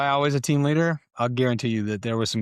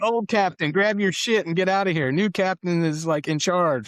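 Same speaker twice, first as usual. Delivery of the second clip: high, louder, laughing-edged order to leave; gleeful dismissal; 7 semitones higher, 3 dB louder than usual. Retold or imitated. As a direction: send the old captain packing with delight. Team sergeant's gleeful dismissal.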